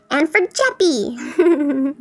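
ordinary delivery

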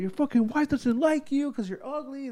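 high-pitched imitated insult; mock heckling. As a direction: jeer in a high voice without shouting. Retold workers heckling him back.

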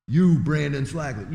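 loud imitation of a preacher singling someone out; mock public call-out. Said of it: "You know, kinda like that".

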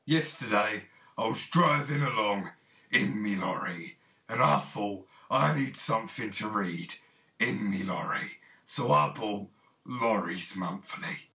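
put-on dialect testimonial, words punched; lorry driver. Lorry driver tells why he bought magazine, mock testimonial. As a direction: play a trucker in a radio ad.

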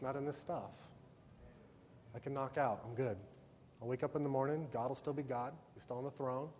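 softly voiced peaceful self-talk before sleep; calm inner reassurance. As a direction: talk yourself down gently, at peace.

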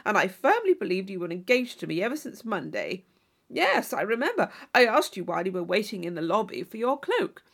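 loud, aggrieved braggart; boastful suitor complaining loudly; blustering John Thorpe. Acted. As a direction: bluster your wounded pride at her.

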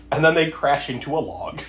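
ordinary talk, nothing marked

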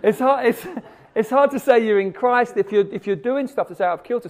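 loud, high insistent preaching; emphatic preaching. Real, not acted. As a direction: drive the point home with force. Preacher pressing a hard point.

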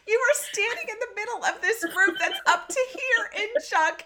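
high, laughing disbelief, cracking up through the words; can't stop laughing while scolding. Real, not acted. Mother to daughter in the junk-filled room, laughing high. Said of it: "I just started cracking up".

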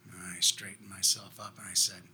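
ordinary delivery

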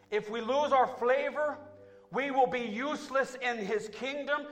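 loud, high, rising preacher's cry to the church; full-force preaching. Acted. Pastor crying out to his church about losing flavor, preaching at full force. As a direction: preach it out at full force, building with each line.

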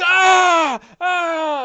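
high, loud agonized yells; burned ogre screaming. Acted. Ogre hit by sacred flame, high pained screams. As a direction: scream as the flame burns you. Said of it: "the sacred flame burns over. He goes".

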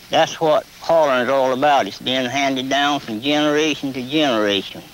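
normal talking voice

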